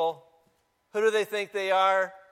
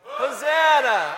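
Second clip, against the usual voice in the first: long loud higher Hosanna; shouted Hosanna; 6 semitones higher, 9 dB louder, slower than usual. Shown as a demonstration. Pastor leading the congregation in a shout, loud. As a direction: lead the shout with full voice. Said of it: "let's try it again".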